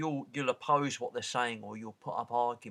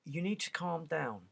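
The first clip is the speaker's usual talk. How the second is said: mock patronising calm; 5 semitones higher than usual.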